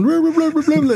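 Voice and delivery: high-pitched mock babble; mocking, garbled high-voiced nonsense; marble-mouthed gibberish. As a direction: imitate someone talking with marbles in their mouth. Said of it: "like they got marbles in their mouth".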